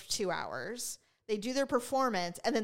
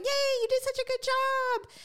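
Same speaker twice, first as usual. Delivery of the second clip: high, bright-toned praise, sing-song; cheery praise. Retold or imitated. Family praising the niece after her first recital. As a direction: gush over the little girl.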